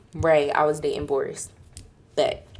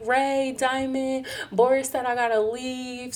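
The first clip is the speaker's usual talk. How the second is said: higher-pitched, mildly whiny upset retelling; retold tearful upset; 6 semitones higher than usual. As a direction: imitate yourself drunk and upset, voice raised in pitch. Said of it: "I was crying and shit".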